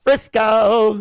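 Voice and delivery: loud, high train-stop announcement; mock conductor call.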